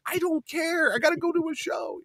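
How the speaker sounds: high-pitched, fed-up comic whine; mock exasperation. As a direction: cut off the rant in a high voice. Fed up with old feud.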